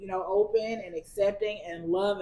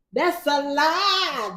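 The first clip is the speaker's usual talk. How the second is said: sing-song; 7 semitones higher, 5 dB louder, much slower than usual. Acted. Repeating the punchline.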